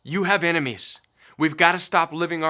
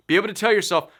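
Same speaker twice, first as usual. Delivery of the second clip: usual speaking voice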